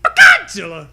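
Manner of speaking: loud high shouted name; cheer. Pretend. Friend echoing the Godzilla cheer. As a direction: bellow it like a monster fan.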